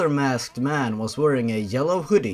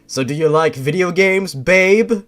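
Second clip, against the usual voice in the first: cocky flirt; 3 semitones higher, 7 dB louder than usual. Acted. Ben flirting, loud.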